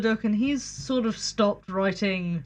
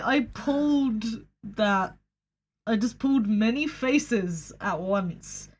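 ordinary talk

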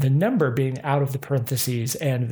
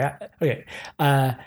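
ordinary delivery

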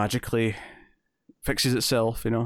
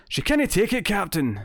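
exaggerated cartoon Scottish accent, pitched high; animated Scottish accent with extra pizzazz; Scotty; 8 semitones higher than usual. Shown as a demonstration. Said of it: "if I'm doing a Scotty impression".

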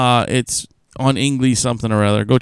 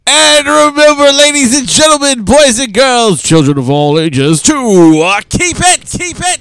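grand entrance announcement; 10 semitones higher, 4 dB louder than usual. Acted. Hosting sign-off announcement.

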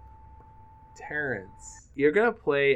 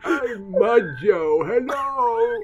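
silly character voice; 10 semitones higher, 5 dB louder, much slower than usual. Acted. Joking persona voice, high and drawn.

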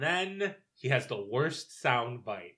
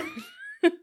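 a high, loud burst of laughter; 8 dB louder than usual. Real, not acted. Cracking up as the co-host stumbles over a senator's name, high burst of laughter.

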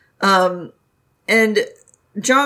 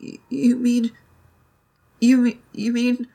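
hushed, breathless you mean; breathless disbelief; 4 semitones higher than usual. Retold or imitated. Jong Chung asking Wei Wuxian about Baoshan Sanren in hushed disbelief. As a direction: barely dare to ask it. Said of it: "And he goes".

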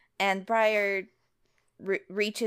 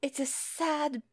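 heartbroken; 8 semitones higher than usual. Acted. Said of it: "Briar just sounds heartbroken".

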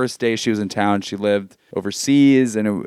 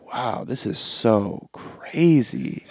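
dazed awe; 4 dB quieter, much slower than usual. Retold or imitated. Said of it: "And I'm watching this just like".

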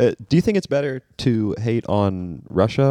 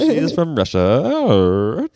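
put-on slow, higher Russian accent swooping up at the end; exaggerated Russian accent; 4 semitones higher, much slower than usual. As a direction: drag out the mock Russian accent. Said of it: "you just go up on the end".

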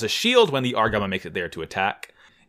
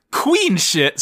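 ordinary delivery

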